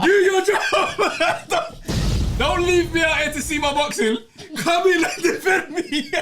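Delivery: high, loud, laughing plea to the security guy; half-laughing mock yell for help, slow and high; mock panicked plea.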